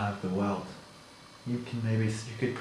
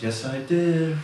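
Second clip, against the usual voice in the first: slightly higher, defensive coworker; lightly voiced coworker; 6 semitones higher, 5 dB louder, slower than usual. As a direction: protest a little, lightly voiced.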